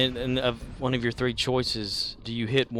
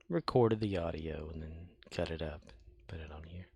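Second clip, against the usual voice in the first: soft whispered aside; 6 semitones lower, 8 dB quieter than usual. Real, not acted. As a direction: whisper the explanation. Whispered explanation.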